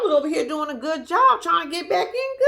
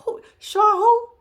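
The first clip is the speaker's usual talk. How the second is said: high, slow, drawn-out feigned ignorance; dismissive Noble; 11 semitones higher, 3 dB louder, much slower than usual. Retold or imitated. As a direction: act like you've never heard of her. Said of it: "That's the vibe Noble giving me".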